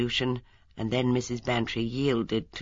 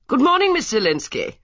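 higher, louder friendly call; friendly greeting; 13 semitones higher, 6 dB louder than usual. A narrator's reading. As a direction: call out a bright friendly hello. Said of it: "she called in a friendly tone".

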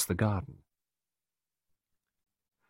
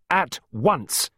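angry repeated order; 9 semitones higher than usual. A narrator's reading. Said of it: "she ordered him".

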